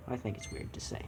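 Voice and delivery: breathy whispered aside; whispering. Real, not acted. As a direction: whisper it under your breath. Aside to himself, embarrassed.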